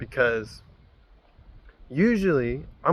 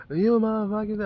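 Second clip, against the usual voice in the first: high mock yell; hammed-up road-rage swearing; imitated raging driver; 8 semitones higher than usual. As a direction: holler the curse high, as from another vehicle. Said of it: "I can barely hear him".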